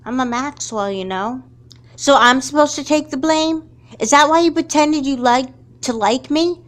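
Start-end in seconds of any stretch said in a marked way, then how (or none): 2.0-6.6 high, louder hurt accusation; stung and accusing; angry. Acted. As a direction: throw the betrayal back at her. Friend accused of blaming her.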